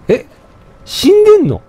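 high, loud shocked question. Real, not acted. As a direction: blurt it out in disbelief. NPC seems to be a corpse. (驚いて高く問いかける)

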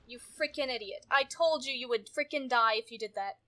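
ordinary delivery

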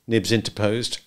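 usual speaking voice

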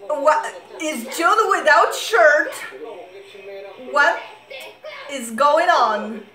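real shock. Real, not acted. Viewer reacting to idol without a shirt, shocked. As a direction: gasp out the questions in astonishment.

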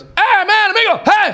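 hammed-up beach-goer shouting at a tourist; yelling Mexican guy. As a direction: holler at the gringo to stop cursing. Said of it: "And he goes".

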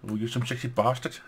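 ordinary delivery

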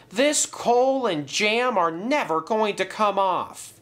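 high-pitched dismayed lament; sad complaint. A narrator's reading. James covered in coal and jam, sadly complaining. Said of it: "cried James sadly".

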